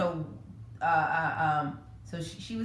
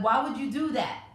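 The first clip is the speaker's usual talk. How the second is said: higher, louder exasperated yell; mock scream; 7 semitones higher, 4 dB louder than usual. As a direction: act out the scolding she could scream. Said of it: "I could scream when she does that".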